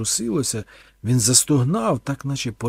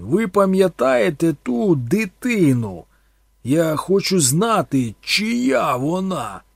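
loud, slow, higher demand; insistent plea; 7 semitones higher, 8 dB louder, slower than usual. A narrator's reading. Thorn demands the priest name the child, loudly. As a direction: press him hard for an answer.

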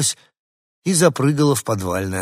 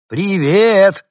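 high, much louder call of "Привет"; louder shout; 11 semitones higher, 10 dB louder than usual. A narrator's reading. Dog calls up to the balcony dog again, much louder. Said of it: "крикнул я погромче".

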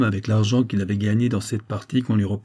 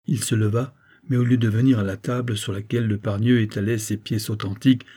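plain reading voice

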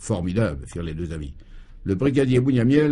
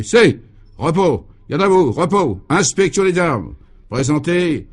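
high, loud sergeant counting out exercises; drill commands; 5 semitones higher, 5 dB louder, slower than usual. A narrator's reading. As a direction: bark orders like a gym drill leader. Brigadier leads absurd physical drill. (commandements militaires criés)

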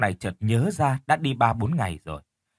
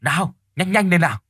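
high, raised, urgent call; hurrying yell; 7 semitones higher, 4 dB louder than usual. A narrator's reading. Wife outside shouts for her husband to hurry, yelling. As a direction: holler at him to hurry. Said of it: "bà Tần vẫn hét lên".

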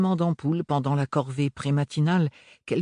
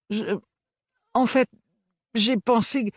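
flustered stammer; 10 semitones higher than usual. Acted.

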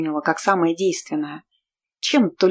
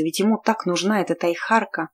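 usual speaking voice

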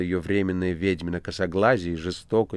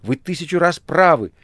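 much higher and louder, fervent cry; exclaiming loudly; 9 semitones higher, 7 dB louder than usual. A narrator's reading. The master eagerly shouting his agreement to Azazello. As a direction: cut him off with an eager shout. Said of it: "вскричал мастер".

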